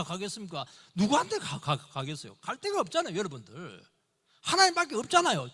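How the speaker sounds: high, loud impassioned sermon; rising preacher's fervor; fervent preaching. Acted. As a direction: preach it with urgency, pleading. Preaching at full force.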